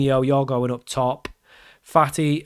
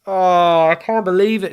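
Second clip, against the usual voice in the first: louder, higher groan of disbelief; anguished disbelief; 4 semitones higher, 5 dB louder than usual. Real, not acted. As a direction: cry out in real dismay. Just conceded late in the match.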